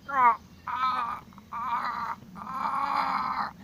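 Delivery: high pained cries of the monster; monster screams. Retold or imitated. Beams strike the monster, high pained cries. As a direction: yelp high as the beams hit. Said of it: "going like".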